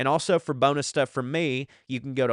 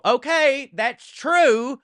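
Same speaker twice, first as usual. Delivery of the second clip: mock agreement; 11 semitones higher, 5 dB louder, much slower than usual. Mock concession, higher voice.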